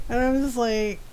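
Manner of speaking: normal talking voice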